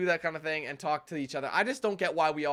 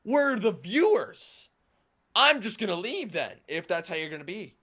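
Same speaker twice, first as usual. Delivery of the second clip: mock outraged viewer; 4 dB louder than usual. Viewers scolding the YouTuber who bit back, mock outrage. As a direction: imitate an offended viewer throwing a huff. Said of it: "And then they lose their mind".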